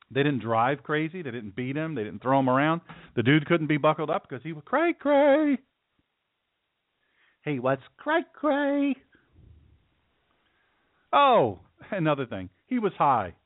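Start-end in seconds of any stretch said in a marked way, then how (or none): 4.6-5.6 high, drawn-out CRAY CRAY; comic singsong slang. Pretend. Clowning on slang for crazy. 7.4-9.0 very slow, high-pitched CRAY CRAY; exaggerated comic slang.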